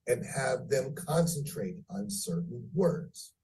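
normal talking voice

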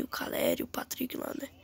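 hushed aside whisper. Real, not acted. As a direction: drop to a whisper for the names. Muttered aside naming players.

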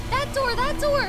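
loud, high urging; excited yelling at a friend; urgent shout. Real, not acted. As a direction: shout directions in a hurry.

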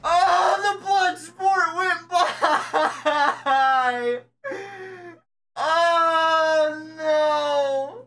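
high crying wail; anguished wail. Real, not acted. Streamer losing the rare skin, high crying wail. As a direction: wail as the prize slips away.

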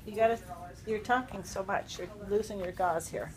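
normal talking voice

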